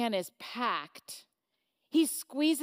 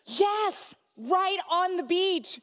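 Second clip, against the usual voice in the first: excited gushing; 5 semitones higher, 4 dB louder than usual. Acted. The woman excitedly talks.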